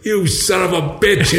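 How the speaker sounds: the girl's dad cursing the boy, mock angry and slow; mock angry father. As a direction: act out her dad's outrage, drawn out. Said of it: "And he goes".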